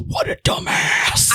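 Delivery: slow, whispered ghost rasp; ghostly whisper. Retold or imitated. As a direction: breathe it like a ghost on tape. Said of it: "somebody just going".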